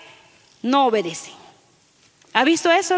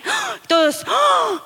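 asombro fingido; 10 semitones higher, much slower than usual. Retold or imitated. Reacción de asombro de todos.

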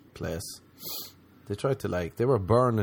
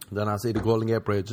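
normal talking voice

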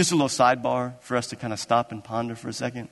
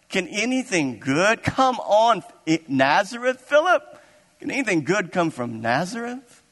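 pitched-up, drawn-out protest with swooping questions; hammed-up disbelief, voice leaping at the end; incredulous scoffing; 5 semitones higher than usual. Nathanael challenges Philip about Nazareth, incredulous.

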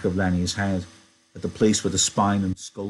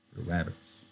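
much softer, subdued repeat; quiet echo; 11 dB quieter than usual. A narrator's reading. George repeats Lenny's shout quietly.

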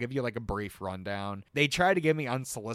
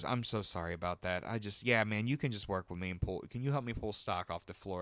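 apologetic manager; 4 semitones lower, 6 dB quieter than usual. Retold or imitated. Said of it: "and he goes".

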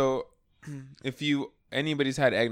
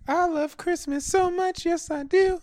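high silly voice; slower than usual. Retold or imitated. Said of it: "I'm like".

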